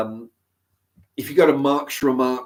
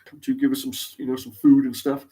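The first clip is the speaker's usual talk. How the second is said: lightly voiced curious chickens, higher; chicken voices; 4 semitones higher than usual. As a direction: chickens wondering who's at the gate. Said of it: "they see, like".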